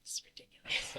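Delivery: unvoiced, annoyed hushed shout; whisper yelling. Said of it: "whisper yelling".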